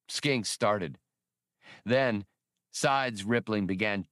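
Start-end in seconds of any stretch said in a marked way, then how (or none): none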